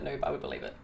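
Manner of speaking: mock deep voice. Teasing reply.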